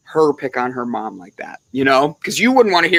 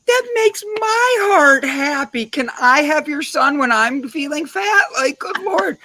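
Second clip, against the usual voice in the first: gushing; 11 semitones higher than usual. Real, not acted. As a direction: swoon over the sweet story, pitched up. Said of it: "touched by the story".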